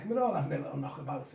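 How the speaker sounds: ordinary talk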